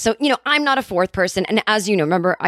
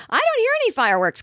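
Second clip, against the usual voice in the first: much higher, fast whiny quote; high mock complaint; 14 semitones higher, faster than usual. Herself complaining about no fireworks, high and fast. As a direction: squeak it out in disbelief.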